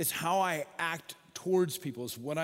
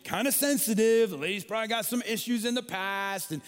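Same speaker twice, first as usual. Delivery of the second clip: louder, higher playful protest; mock scolding; 5 semitones higher, 6 dB louder than usual. Real, not acted. Preacher jokingly rebuking Jesus for shaming her, louder and higher. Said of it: "I took classes in psychology".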